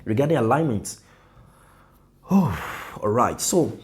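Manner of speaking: usual speaking voice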